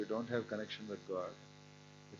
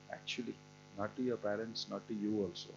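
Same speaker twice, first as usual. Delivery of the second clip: ordinary talk, nothing marked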